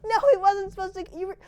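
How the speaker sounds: very high loud panicked shriek; horrified scream. A narrator's reading. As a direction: shriek in horror as the vision comes true. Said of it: "horrified scream".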